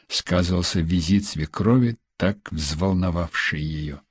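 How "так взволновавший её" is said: plain reading voice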